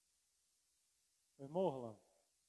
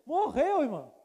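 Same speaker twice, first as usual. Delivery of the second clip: higher, louder horrified question; shocked cry; 3 semitones higher, 7 dB louder, slower than usual. Retold or imitated. Pastor learning the neighbour died, horrified high cry. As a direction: squeak it out in horror.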